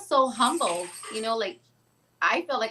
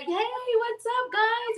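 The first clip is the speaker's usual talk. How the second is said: much higher, drawn-out hello; slow, high mimic greeting; 12 semitones higher, much slower than usual. Imitating Lou Diamond greeting the group, high slow voice. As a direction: stretch the greeting like a star.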